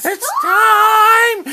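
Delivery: very high, very loud shout; full shout. Real, not acted. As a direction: belt it out to wake her. Waking daughter, bigger yell.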